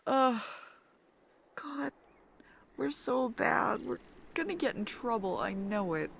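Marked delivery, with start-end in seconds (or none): none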